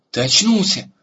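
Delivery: high, loud cry of joy; joyful exclamation. Acted. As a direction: burst out with relief and joy. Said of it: "радостно воскликнул Эрагон". (радостный возглас)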